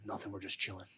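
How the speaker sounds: a hint of a sleepy friend picking up; lightly imitated drowsy answer; mumbled hello. Gardini's lazy reply on phone.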